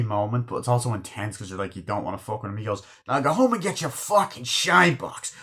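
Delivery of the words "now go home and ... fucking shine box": high-pitched mock-angry jab; retold taunting insult. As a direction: hurl the insult in a raised, put-on voice. Acted insult in bar quarrel.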